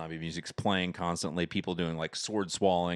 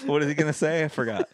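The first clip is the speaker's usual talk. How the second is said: laughing; 6 semitones higher, 5 dB louder than usual. Real, not acted. Still laughing at the bit.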